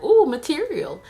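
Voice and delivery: high, loud delighted ooh; mock excited. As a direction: pounce on it like an eager writer. Voices writer dad spotting story material.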